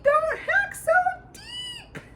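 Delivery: desperate high squeak trailing off; screaming. A narrator's reading. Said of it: "screamed at the top of his lungs".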